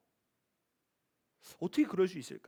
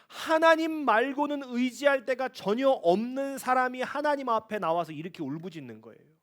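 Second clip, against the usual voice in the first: ordinary delivery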